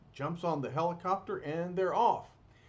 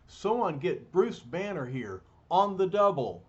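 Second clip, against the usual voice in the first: loud, higher command across the base; barked order; 4 semitones higher, 6 dB louder than usual. Acted. General Ross loudly orders his men to fetch Bruce Banner at once. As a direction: bark it at the soldiers.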